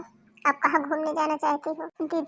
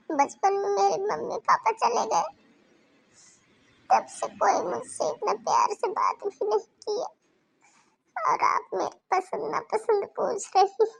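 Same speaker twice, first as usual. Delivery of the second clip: tearful, higher voice breaking through her words; crying; 6 semitones higher than usual. Acted. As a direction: let tears choke the gratitude. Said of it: "आप रोना बंद करिए".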